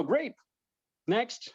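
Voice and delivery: high-pitched, breezy dismissal; upbeat brush-off. Retold or imitated. Rejected guy moving on after a no, upbeat. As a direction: lift the pitch and move briskly on.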